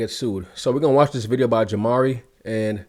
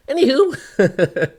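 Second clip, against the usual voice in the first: high, very slow, laughing cartoon drawl; cartoon Sandy voice; 13 semitones higher, 5 dB louder, much slower than usual. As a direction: stretch it out like a goofy cartoon. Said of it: "She sound like real life Sandy".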